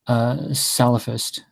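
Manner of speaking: "Salafist" spelled out slowly, SAL-a-fist, with a clear final -st; slow careful pronunciation. Shown as a demonstration. Answering how to pronounce it, saying "Salafist" slowly and clearly. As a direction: model "Salafist" slowly for the other speaker, every syllable. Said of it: "how do you pronounce it".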